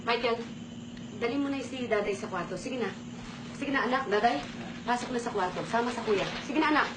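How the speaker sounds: put-on hushed, gentle urging of the children; quiet coaxing. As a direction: say it softly so the kids leave. Sending children away, soft. (mahinang pag-amo)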